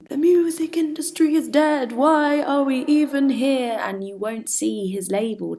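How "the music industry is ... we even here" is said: crying out. Acted. Poet mimicking a musician on the floor, crying out. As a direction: cry it out in loud, theatrical despair.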